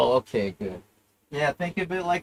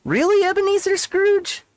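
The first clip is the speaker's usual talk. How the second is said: very high, louder ghostly cry; 4 dB louder than usual. Acted. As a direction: squeal it in ghostly disbelief. Ghost mocks Scrooge.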